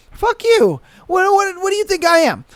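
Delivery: high, indignant mock outburst; mock outrage. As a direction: play the stranded partner snapping back. Acted dumped partner on bus.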